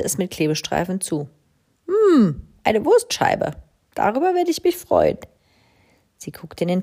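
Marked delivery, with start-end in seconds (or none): 1.9-5.2 high delighted squeal; delighted crow. A narrator's reading. Crow rummaging her cupboard, delighted. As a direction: pounce on a treasure.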